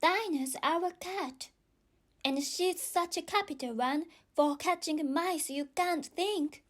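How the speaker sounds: put-on high, eager girl chatter. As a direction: rave about her pet cat. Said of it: "Alice replied eagerly".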